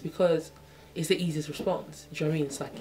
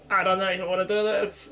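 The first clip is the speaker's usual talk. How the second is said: higher, louder anxious inner voice; hammed-up worry about opening up; mock nervous; 4 semitones higher, 6 dB louder than usual. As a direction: play the panicked inner thought. Voicing her anxious inner thoughts.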